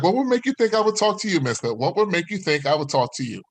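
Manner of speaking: high-pitched offended woman; mock indignant rebuff. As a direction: imitate a woman scornfully turning him down. Imitated woman rebuffs him indignantly.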